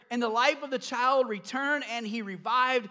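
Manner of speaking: ordinary talk, nothing marked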